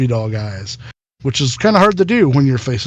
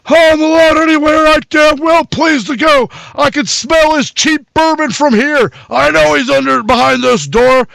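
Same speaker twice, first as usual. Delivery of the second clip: high, loud furious yell through the door; screaming old man; 11 semitones higher, 4 dB louder than usual. Acted.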